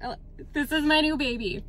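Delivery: ordinary delivery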